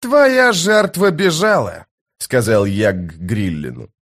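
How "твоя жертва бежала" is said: slow, high-pitched projected pronouncement; stately, raised warning; commanding Jhag warrior. Acted. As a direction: declare it firmly to a dangerous foe. Jhag addresses the beast Gryllen.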